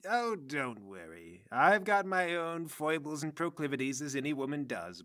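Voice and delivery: put-on old madam, languidly untroubled; knowing reassurance. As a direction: wave it away in a worldly matron's voice. Madam reassures the flustered warden.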